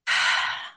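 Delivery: audible exhale; soft breathy sigh; demonstrated breath. Shown as a demonstration. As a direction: let out a short sighing breath. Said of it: "pay attention to your own breathing".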